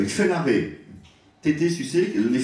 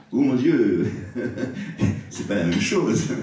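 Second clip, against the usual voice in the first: laughing through the words; amused laughter. Real, not acted. Lecturer amused by the Italian gender contrast, laughing. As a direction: break into chuckles while exclaiming. Joke on Italian word endings.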